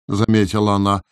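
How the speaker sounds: ordinary delivery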